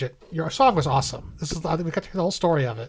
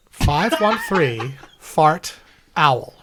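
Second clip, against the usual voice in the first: very slow, louder, each word set apart; very slow emphatic number; 4 dB louder, much slower than usual. Shown as a demonstration. Host repeating the number slowly for listeners. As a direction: drag it out, punching owl. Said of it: "there's your mnemonic".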